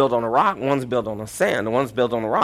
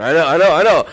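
louder and higher, conceding to the crowd; loud pleading concession; 4 semitones higher, 8 dB louder, faster than usual. Real, not acted. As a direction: raise your voice and concede it fast. Preacher fending off disapproval.

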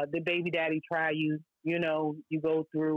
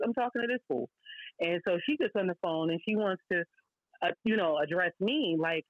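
ordinary talk

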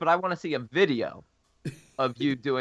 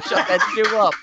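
high, loud put-on dog voice through laughter; voicing the barking dog; 7 semitones higher, 7 dB louder than usual. Joking about the dog barking, a high loud laughing dog voice. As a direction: give the German shepherd a loud, laughing growl of a line. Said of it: "It's like".

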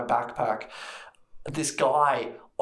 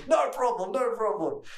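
much higher, breezy; mock attendant reply; 10 semitones higher than usual. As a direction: wave him through cheerfully. Attendant replies.

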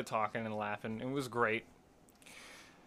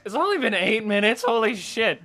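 high, loud disbelief; real astonishment; 7 semitones higher, 8 dB louder than usual. Real, not acted. Host seeing only eight minutes passed, loud astonishment. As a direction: exclaim it, stunned by the clock. Shocked at how little time passed.

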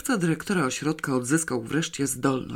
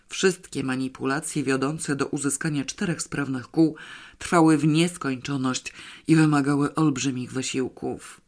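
ordinary delivery, nothing marked

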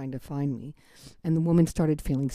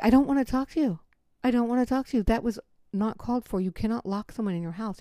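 upset raised voice; 7 semitones higher than usual. Retold or imitated. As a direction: push him away, upset. Confronting the ex.

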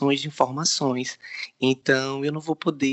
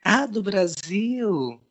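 surprised, slow; 7 semitones higher, much slower than usual. Acted. Angel surprised at Brazil.

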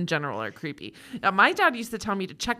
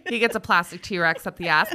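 ordinary talk

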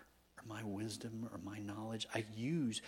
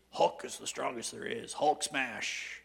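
loud mock Hulk brag; a hint of a louder, boastful Hulk; 3 semitones higher, 9 dB louder, slower than usual. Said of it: "go like Hulk in the Avengers".

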